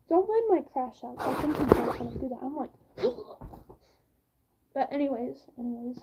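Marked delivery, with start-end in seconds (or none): none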